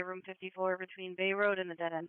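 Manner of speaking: usual speaking voice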